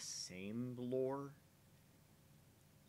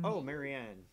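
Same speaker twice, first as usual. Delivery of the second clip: surprised; 6 semitones higher, 8 dB louder than usual. Acted. Acted realisation.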